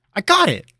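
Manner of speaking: high, loud eager cry; excited interruption. Acted. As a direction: jump in bursting with an idea. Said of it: "snapped his fingers".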